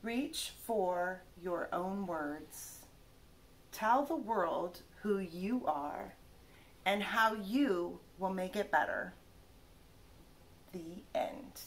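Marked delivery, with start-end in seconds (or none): none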